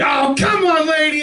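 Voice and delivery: dad road rage yell. Retold or imitated. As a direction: bellow at the car ahead like an angry dad. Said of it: "Somebody slams on their brake".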